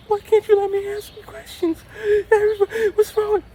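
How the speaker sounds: very high indignant squeak; squeaky mock indignation; mock offended girlfriend. Mimicking upset girlfriend.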